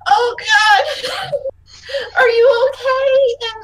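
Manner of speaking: high, drawn-out panicked fussing; alarmed concern. Acted. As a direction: cry out in worry, stretching the question. Nervous character fussing over injured child.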